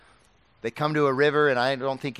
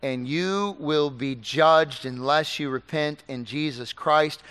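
ordinary delivery

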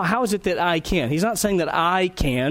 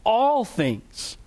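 ALL pitched high, then falling; stress on ALL; 5 semitones higher than usual. Shown as a demonstration. Preacher showing the congregation Paul's emphasis, stress on "all". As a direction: lean hard on the word "all". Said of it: "He emphasizes the word all".

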